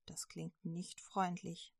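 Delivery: plain reading voice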